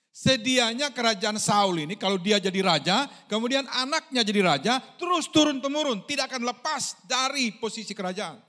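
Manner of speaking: a preacher pressing the lesson hard; emphatic preaching. Acted. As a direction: drive the point home to the congregation. Preaching point with force.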